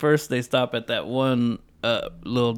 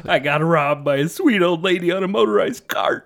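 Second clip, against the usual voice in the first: high, breathy quote with a laugh in it; quoting a movie character; 4 semitones higher than usual. Retold or imitated. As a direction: act out the line in a high voice.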